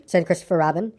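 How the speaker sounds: ordinary delivery, nothing marked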